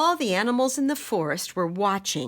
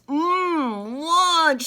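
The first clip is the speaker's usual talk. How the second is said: hungry chameleon; 9 semitones higher, 5 dB louder than usual. Acted. Chameleon eyes a plump bug.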